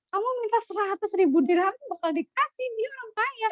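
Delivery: high-pitched incredulous scolding; playful, high retold reproach; mock chiding. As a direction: imitate a comrade chiding a friend's missed chance. Comrade chiding him over small ransom.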